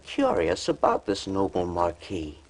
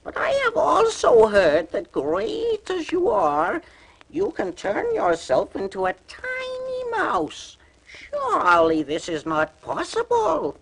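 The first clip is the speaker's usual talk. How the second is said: sly teasing cat. A narrator's reading. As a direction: goad the ogre sweetly. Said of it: "cried Puss".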